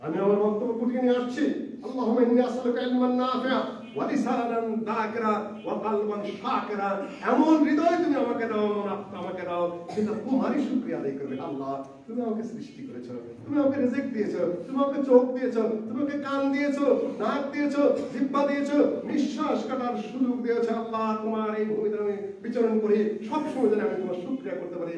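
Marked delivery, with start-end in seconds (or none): 1.9-6.9 recited prayer. Acted. Preacher reciting a prayer to the congregation, chanted. 7.3-24.9 raised, fervent prayer voice; heartfelt prayer. Acted. Preacher praying aloud before the congregation, fervent.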